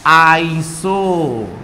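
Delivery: very slow stretched iso; drawn out. Shown as a demonstration. Teacher drawing the prefix out for the class. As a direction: stretch it long.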